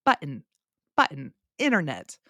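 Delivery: "button" with a swallowed T, "internet" as "innernet"; dropped T's. Shown as a demonstration. Teacher demonstrates her American accent, no T in "button" or "internet". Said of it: "I do not pronounce the T's".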